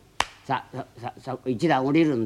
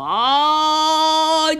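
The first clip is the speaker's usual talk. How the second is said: long, slow, high and loud "あーい"; drawn-out call; 8 dB louder, much slower than usual. Retold or imitated. Boatman calling out long, high and loud. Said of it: "ってなことを言います".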